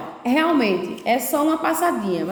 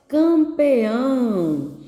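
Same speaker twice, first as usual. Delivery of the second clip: CAMPEÃO extremely slow, syllables drawn right out; dictation; much slower than usual. Shown as a demonstration. Teacher dictates to pupils writing in circles, very slow stretched delivery. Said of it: "Vocês vão escrever aí".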